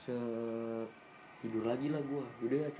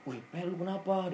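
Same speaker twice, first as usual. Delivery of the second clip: higher, startled, worried question; a touch of alarm, pitched up; imitating a scared friend; 6 semitones higher than usual. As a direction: mimic a frightened tentmate waking. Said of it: "si Andre ketakutan".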